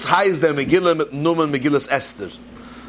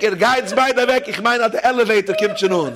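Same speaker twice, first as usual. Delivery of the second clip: high-pitched mock call to companions; 6 semitones higher than usual. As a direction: call over to your drunk pals, pitch lifted. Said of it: "Rief zu der dritte Schick".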